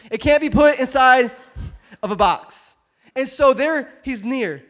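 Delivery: high, very loud sermon climax; full-force preaching. Real, not acted. Preacher repeats that God can't be boxed, full-force preaching. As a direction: drive the point home loudly.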